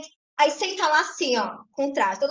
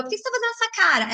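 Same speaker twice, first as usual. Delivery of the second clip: teasing question; 7 semitones higher, much faster than usual. Retold or imitated. Quotes herself teasing her daughter.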